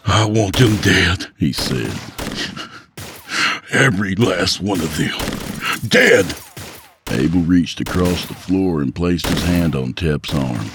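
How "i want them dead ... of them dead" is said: grieving grandson swears revenge, building to a shout; vengeful. Acted.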